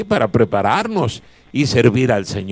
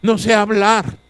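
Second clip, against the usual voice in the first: put-on higher, small timid boy's voice; timid young Jeremiah; 7 semitones higher than usual. Pastor voices Jeremiah excusing himself before God, timid and higher. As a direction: plead like a frightened child who can't speak. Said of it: "que dijo".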